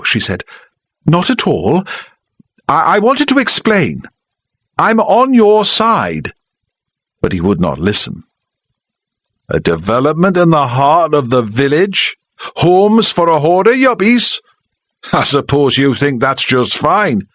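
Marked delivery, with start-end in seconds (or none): 1.1-6.3 high, urgent protest slowing to the end; insist, stumbling a little; flustered protest. Acted. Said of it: "She felt hot and angry". 9.5-17.2 high sarcastic heckle; mock her for the whole room; jeering local man. Charlie jeering.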